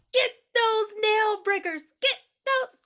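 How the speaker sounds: high, slow, bratty command; bratty order. Retold or imitated. Spoiled girl ordering her friends after her nail, high and slow. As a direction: demand revenge like a spoiled brat. Quoting movie line.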